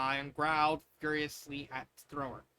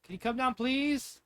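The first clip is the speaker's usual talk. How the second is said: louder, higher call; calling upstairs; 7 semitones higher, 6 dB louder, slower than usual. Acted. Mother calling daughter down, higher call. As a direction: call up the stairs. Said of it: "called Ruby".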